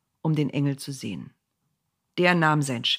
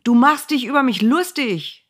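loud, higher, beside himself with rage; furious shout; 8 semitones higher, 8 dB louder than usual. A narrator's reading. Bileam shouts at his donkey, furious and loud. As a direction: yell it out of control. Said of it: "schrie Bileam ganz außer sich".

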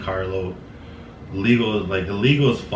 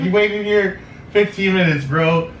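amazed; 10 semitones higher, 5 dB louder, slower than usual. Retold or imitated. Amazed at friend's loyalty.

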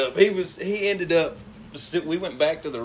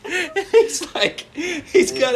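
high squeaky laughing through words; laughing hard; 14 semitones higher, 4 dB louder, much slower than usual. Real, not acted. Telling friends the vacuum story, laughing hard. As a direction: barely get it out for laughing. Cracking up retelling.

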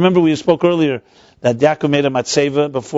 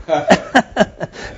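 amused laugh; 4 semitones higher than usual. Real, not acted. Laughs at own joke.

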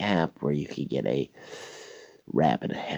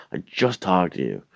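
usual speaking voice

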